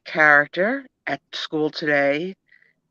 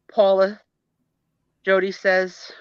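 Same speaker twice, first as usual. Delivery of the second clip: ordinary delivery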